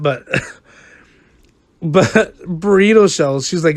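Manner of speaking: ordinary talk, nothing marked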